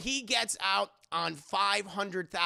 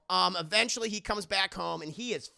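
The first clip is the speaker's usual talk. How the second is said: normal talking voice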